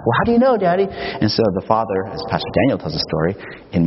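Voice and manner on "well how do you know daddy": very high imitation of a child; insistent little boy. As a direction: press Dad with a kid's lifted, questioning voice. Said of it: "the kid hears the bee".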